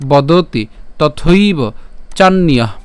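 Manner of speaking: বদতি, তথৈব, চান্যঃ each set apart by pauses; word-by-word demonstration. Shown as a demonstration. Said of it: "যেটা উচ্চারণ করেছি আমরা". (বদতি, তথৈব, চান্যঃ আলাদা করে স্পষ্ট উচ্চারণ)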